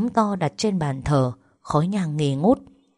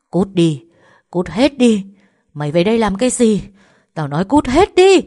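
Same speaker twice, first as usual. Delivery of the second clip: shouting, louder and higher as it builds; furious shout; 4 semitones higher, 8 dB louder than usual. A narrator's reading. Mad mother screaming at her returning daughter, rising fury. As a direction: scream them out of the house in rage. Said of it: "Bà Thìn quát lớn".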